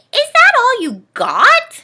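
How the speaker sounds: very high, loud, slow shout. Acted. Ness shouting at Dorothy, loud. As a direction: shout it across the snow. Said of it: "Ness shouted".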